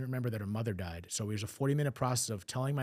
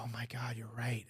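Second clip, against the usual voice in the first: much softer, fading into a whisper; soft whispered realization; 6 dB quieter, slower than usual. Retold or imitated. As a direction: let it dawn on you and sink to a whisper. Mom realizing the truth.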